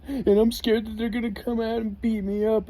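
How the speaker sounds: high, panicky imitation of a frightened friend; scared friend.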